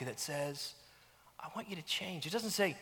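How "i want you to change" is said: much softer, gentle nudge; soft spirit voice. Pretend. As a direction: speak it like a soft inner voice. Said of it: "that soft, still spirit nag".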